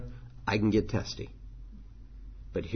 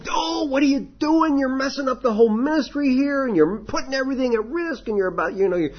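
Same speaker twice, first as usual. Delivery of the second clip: harsh scolding; 13 semitones higher than usual. Retold or imitated. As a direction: blow up at the volunteer who messed up. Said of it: "It's not like".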